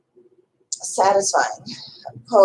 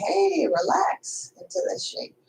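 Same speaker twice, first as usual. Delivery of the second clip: soothing; 10 semitones higher, 5 dB quieter than usual. Pretend. Voicing a soothing inner instruction.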